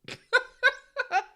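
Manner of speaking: short, high, loud laugh; real laugh bursts out; high burst of laughter. Real, not acted. Host laughing at the over-the-top scene, high laugh.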